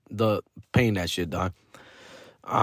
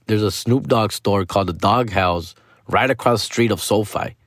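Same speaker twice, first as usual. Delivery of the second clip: ordinary talk, nothing marked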